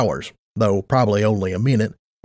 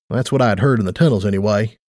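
usual speaking voice

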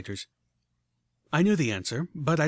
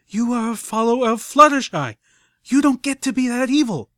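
much higher, louder angry bark; 10 semitones higher, 9 dB louder than usual. A narrator's reading. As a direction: bark it at her, furious. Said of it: "I barked".

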